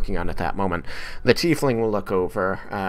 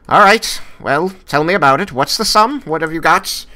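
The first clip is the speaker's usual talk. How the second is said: much higher and louder, quick businesslike questions; brisk gnome banker; 10 semitones higher, 8 dB louder than usual. Acted. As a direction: get straight to business, pitched high. Gnome asks the sum.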